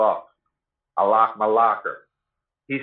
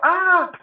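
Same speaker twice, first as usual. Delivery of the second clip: short, much higher mock shriek; imitated little-girl scream; 4 dB louder than usual. Bitten man screaming during the jail fight, in a high mock shriek. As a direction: squeal it like a scared little girl. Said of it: "He's screaming like a little girl".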